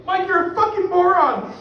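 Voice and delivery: high, strained yell at himself; mock self-berating. Man cursing himself over wasted money, mock furious yelling.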